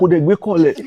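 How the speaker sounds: complaining boss in Yoruba. Retold or imitated. Creative director complaining that calls go unanswered, complaining. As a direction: imitate an exasperated boss in Yoruba. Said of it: "he speak Yoruba".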